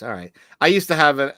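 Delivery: ordinary talk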